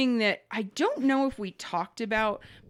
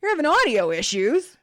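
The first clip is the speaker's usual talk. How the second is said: high, loud mocking quote; exaggerated retold greeting; mimicking the tech; 7 semitones higher, 6 dB louder than usual. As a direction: throw the tech's line back incredulously. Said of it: "getting on the line to me and saying".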